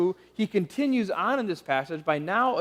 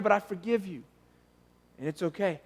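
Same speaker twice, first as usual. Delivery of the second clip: gentle fatherly reassurance; 7 dB quieter, faster than usual. Acted. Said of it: "a dad hugging his kid".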